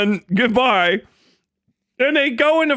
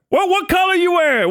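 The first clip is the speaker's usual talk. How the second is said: street tough taunt; 9 semitones higher than usual. Pretend. Acted street confrontation.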